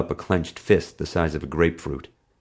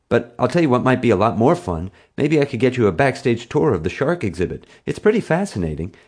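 higher, louder sales pitch; persuasive; 6 semitones higher, 3 dB louder than usual. A narrator's reading. Boy offering the bully a backstage tour instead, persuasive. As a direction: talk it up with growing eagerness.

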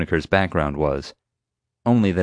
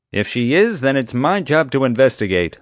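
loud, raised-pitch insistence; officious security chief; 7 semitones higher, 5 dB louder than usual. A narrator's reading. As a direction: claim the job as yours alone. Chief asserts his authority.

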